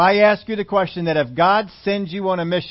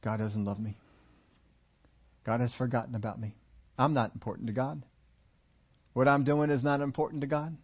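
frustrated inner voice; 5 dB quieter than usual. Retold or imitated. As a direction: voice the hopeless thoughts quietly. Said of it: "and you're thinking".

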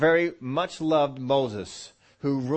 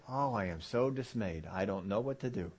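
softer, sinking voice of someone discouraged; mock dismay; 5 semitones lower, 9 dB quieter than usual. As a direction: act out mild helpless dismay, dropping low. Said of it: "come out in a situation and say".

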